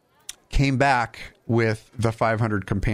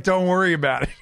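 higher-pitched, hurried-sounding cover-up, played for laughs; mock brush-off; 7 semitones higher, faster than usual. Laughing worker to a nosy coworker, mock dismissive. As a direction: wave the question away in a raised voice.

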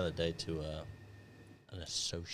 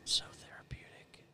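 whisper; 9 semitones higher than usual. Real, not acted. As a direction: whisper with relish. Relishing needle feeling.

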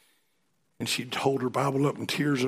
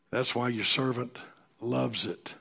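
normal talking voice

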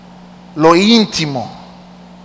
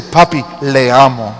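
"le amo" said lower, stiffly; lower, stiff mock-formal "Papi, le amo"; mock formal child; 4 semitones lower than usual. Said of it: "No dice".

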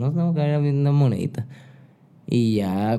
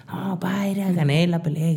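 higher-pitched imitation of a happy kid; mildly excited, higher voice; happy announcement; 6 semitones higher than usual. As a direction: retell it like a proud son telling dad. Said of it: "yo llegué bien feliz y le dije".

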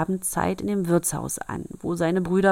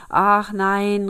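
meek cry; 3 semitones higher, 7 dB louder than usual. Acted. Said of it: "rief der Wirt ganz kleinlaut".

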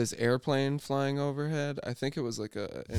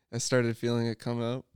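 usual speaking voice

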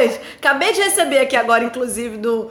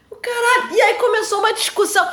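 much higher laughing outburst; laughing outrage; 9 semitones higher than usual. Real, not acted. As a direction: laugh in disbelief.

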